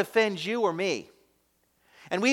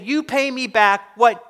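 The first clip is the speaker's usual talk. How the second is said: louder, higher, slow demand for payment; 5 semitones higher, 7 dB louder than usual. Acted. Servant choking his fellow servant, demanding repayment. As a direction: grab him and demand the money back. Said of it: "and demanded".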